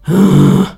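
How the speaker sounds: big exasperated sigh; loud sigh. Acted. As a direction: let out a loud weary sigh. Grasshopper sighs before reply.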